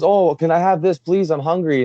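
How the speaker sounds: lightly voiced hungry kid begging; child begs mom for food; imitating a kid. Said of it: "the kid goes".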